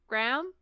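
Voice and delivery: "Graham" as one syllable, rhyming with ham; American pronunciation. Shown as a demonstration. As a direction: say "Graham" squashed into "gram". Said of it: "do you say Graham?".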